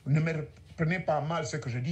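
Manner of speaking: usual speaking voice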